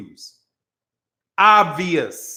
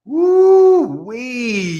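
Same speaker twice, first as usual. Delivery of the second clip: admiring woo-wee; 3 semitones higher, 3 dB louder than usual. Retold or imitated. Admiring Stacey Dash's looks.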